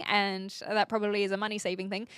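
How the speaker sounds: ordinary talk, nothing marked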